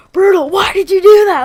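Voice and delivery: loud, high-pitched furious scolding; outraged shout; really mad teacher. Retold or imitated. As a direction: imitate a teacher yelling at a kid. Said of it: "she was getting really mad".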